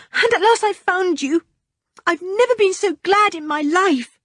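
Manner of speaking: put-on young boy rushing out his frightening tale; anxious boy. Richard continues his story.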